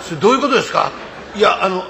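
usual speaking voice

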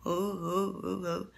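low, soft imitated ghost sobs; ghostly crying. Storyteller imitating crying heard at night, soft ghostly weeping. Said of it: "Nghe tiếng khóc".

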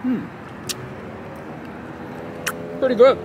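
chewing while talking; muffled by food in mouth; mouth full. Real, not acted. Seen 0: Left man bit burger at -4, chews closed-mouthed through "Hmm, pretty good.

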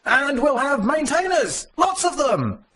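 high, eager mock voice of an overconfident colleague; mimicking Bozidir's flame post, high and eager; mock enthusiasm. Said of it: "he wrote a flame post and said".

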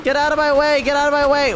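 high, pressing command; urgent shouting. Real, not acted. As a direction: yell to clear a path, pitch way up. Fleeing fire, ordering enemy aside.

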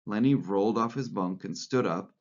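plain reading voice

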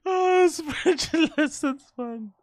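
slow, high, giggling through the words; can't get it out for laughing; amused, high laughing delivery. Real, not acted. Laughing at title.

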